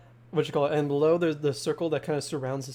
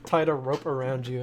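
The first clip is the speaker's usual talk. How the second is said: usual speaking voice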